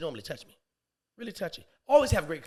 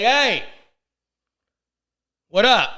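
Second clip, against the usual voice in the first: loud, raised-pitch challenge; calling through a door; 6 semitones higher, 14 dB louder, slower than usual. Acted. Man calling to the neighbor through closed door, loud hail. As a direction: call out sharply.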